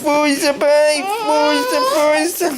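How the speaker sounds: high, sing-song cheering of força; cheering him on. Real, not acted. Father cheering his baby son to push, high sing-song.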